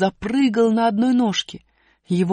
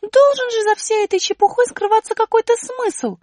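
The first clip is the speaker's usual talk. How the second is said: высоко и громко, недоумевая; маленькая героиня возмущённо спрашивает; высокий удивлённый голос; 6 dB louder than usual. Acted. Аля рассуждает. (высокий удивлённый голос героини)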